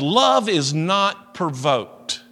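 very slow, weighed out; reading scripture deliberately; slow emphatic quote. Real, not acted. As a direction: let each word sink in. Scripture read slowly.

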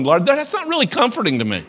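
complaining to God while laughing; laughing protest. Retold or imitated.

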